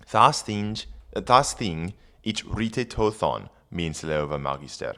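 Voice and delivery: put-on reading Old English aloud; careful Old English recitation. Shown as a demonstration. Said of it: "rereading the Old English".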